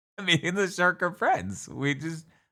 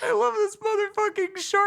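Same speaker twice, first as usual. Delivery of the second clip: very high, delighted squeal. Real, not acted. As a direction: gush over it in a squeaky voice. Gushing over cute shark.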